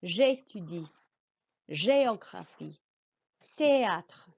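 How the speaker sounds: J'étudie, géographie, théâtre with a clear, bright French é; teacher modelling French é sounds; careful French pronunciation. Shown as a demonstration. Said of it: "your voice must go up on the E".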